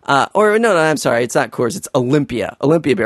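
ordinary talk

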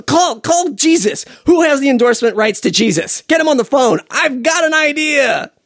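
high, eager call to the phones; a hyped exec grabbing his big idea; excited pitch man. Pretend. Ad man shouting to his staff about the Jesus winemaker, high and eager.

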